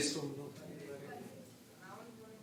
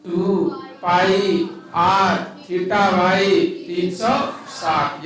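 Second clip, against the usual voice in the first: slow dictation; much slower than usual. Shown as a demonstration. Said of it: "यानी चाप का लंबाई क्या हो जाएगा?".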